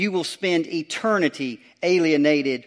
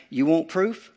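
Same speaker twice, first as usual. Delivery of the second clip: Jesus voiced; 3 semitones higher than usual. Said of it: "He's about to say to them".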